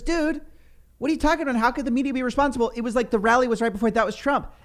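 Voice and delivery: high, rapid exasperated protest; mock incredulity. Host plays man disputing media blame, high incredulous protest. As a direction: rattle it off high, in disbelief.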